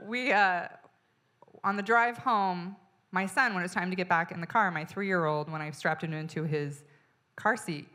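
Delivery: ordinary delivery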